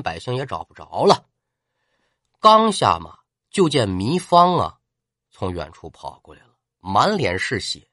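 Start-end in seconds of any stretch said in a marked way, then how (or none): none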